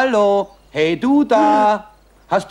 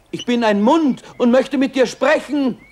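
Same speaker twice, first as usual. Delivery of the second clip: ordinary talk, nothing marked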